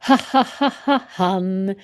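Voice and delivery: "ha, ha, ha, ha" in short repeated puffs, then "han"; H sound drill. Shown as a demonstration. Teacher shows learners how to practise H, repetitive sound drill. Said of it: "då kan man öva".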